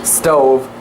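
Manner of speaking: ordinary delivery, nothing marked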